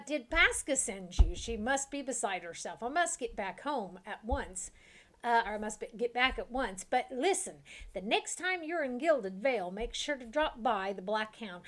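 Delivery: a hint of Southern accent, eager cook voice; lightly Southern, urgent to get home; slight Southern accent. Freed cook urging the party to visit, slight Southern accent. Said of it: "natural Southern accent comes to your mouth".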